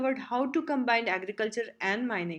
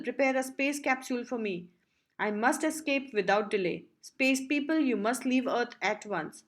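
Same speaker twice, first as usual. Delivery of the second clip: ordinary delivery, nothing marked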